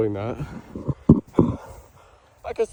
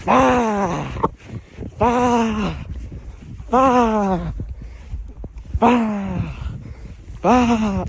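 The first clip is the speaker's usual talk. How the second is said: sharp pained yelps; cold yelps; 6 semitones higher, much slower than usual. Real, not acted. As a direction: yelp at each cold step. Barefoot in the cold, crying out.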